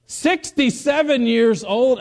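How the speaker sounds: high, slow, incredulous delivery; amazed emphasis. Real, not acted. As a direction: stretch out the age in open amazement. Amazed repeat of the woman's age.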